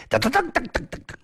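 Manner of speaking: quick high patter of running footsteps; footstep sounds. A narrator's reading. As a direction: rattle the steps off fast like running feet. Footsteps imitated by storyteller.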